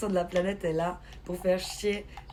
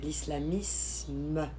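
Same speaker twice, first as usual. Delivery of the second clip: "L'islamisme" very slow and low, "isme" lingered on; pointed enunciation; 4 semitones lower, 5 dB quieter, much slower than usual. Real, not acted. Streamer makes the distinction clear, "L'islamisme" stretched out slowly. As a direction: repeat "L'islamisme" softly, dragging out the ending. Repeats the term to stress suffix.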